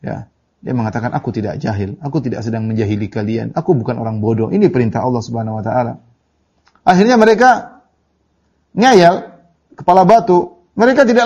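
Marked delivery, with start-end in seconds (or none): 1.2-6.0 low, soft, patient reply; imitating a prophet's unruffled restraint; calm, not angry. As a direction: answer the accusation quietly and without anger. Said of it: "dia tidak marah".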